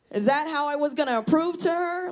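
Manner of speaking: ordinary talk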